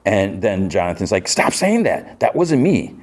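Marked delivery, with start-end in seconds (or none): 1.3-2.9 much higher, snapped in protest; upset outburst. Retold or imitated. Son snaps at his parents to stop, voice pitched up high in protest. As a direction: push back hard at your parents, voice shooting up.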